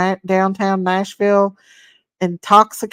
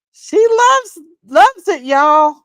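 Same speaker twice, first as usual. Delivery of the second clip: much higher, delighted; excited gushing; 7 semitones higher, 4 dB louder, slower than usual. Real, not acted. Telling viewers about a friend's gift, high excited gushing. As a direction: gush with delight.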